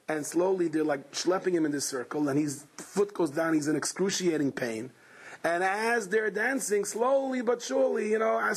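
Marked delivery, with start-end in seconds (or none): none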